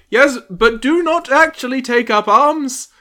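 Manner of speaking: high, loud, nervous pleading voice; timid plea. Acted. Giles timidly pleading with the crowd. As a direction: beg them not to take up arms. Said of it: "very, timidly".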